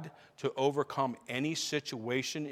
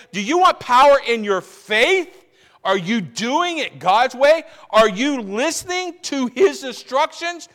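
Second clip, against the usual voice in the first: loud, high preaching with driving questions; full-force preaching; 8 semitones higher, 15 dB louder than usual. Real, not acted. Preacher challenging the congregation on following God's way, full-force preaching. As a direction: hammer each question home, rising in pitch and volume.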